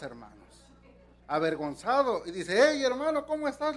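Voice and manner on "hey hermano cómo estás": put-on drunk husband. Drunk husband greets the young helper, loud and high.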